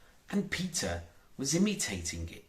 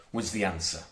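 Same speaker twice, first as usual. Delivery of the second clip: ordinary delivery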